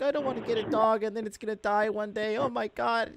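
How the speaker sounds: mildly weepy girlfriend imitation; imitating upset girlfriend. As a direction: mimic a girlfriend getting tearful. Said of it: "She starts crying".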